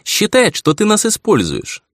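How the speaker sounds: put-on high girlish doll voice; young ringing voice. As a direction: sound like a schoolgirl. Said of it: "Голос у неё оказался юный и звонкий". (звонкий голос юной куклы)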